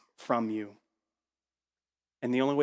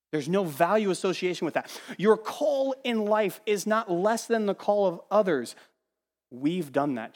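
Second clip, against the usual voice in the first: ordinary talk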